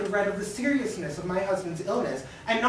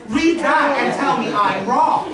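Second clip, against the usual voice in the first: angry demand; 3 semitones higher, 7 dB louder than usual. Acted. Demanding woman escalates.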